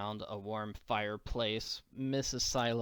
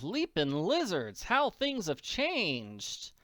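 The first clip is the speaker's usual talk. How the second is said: put-on higher, slow wondering girl voice; amazed Annie; 5 semitones higher, slower than usual. Annie arrives at the Silos' farm, slow and amazed. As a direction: take in how much changed.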